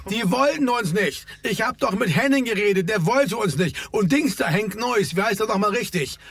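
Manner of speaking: exasperated argument, moderately heated; agitated. A narrator's reading. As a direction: rant with pitch held up, no shouting. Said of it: "rief Ferdi erregt".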